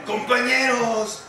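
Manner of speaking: pompous president speech. Retold or imitated. Announcing to the group he is president, pompous. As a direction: declare it like the president of the Republic. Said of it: "como si hubiera sido presidente de la República".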